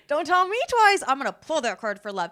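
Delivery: high, loud gleeful eagerness; eager. Retold or imitated. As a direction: jump at the offer with an excited laugh. Acted eager reply to reader.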